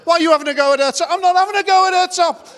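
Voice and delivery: high, loud bickering daughter; heated squabble. Retold or imitated. Second daughter joins the row in a loud, high squabble. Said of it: "And then the second one".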